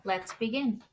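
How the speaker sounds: usual speaking voice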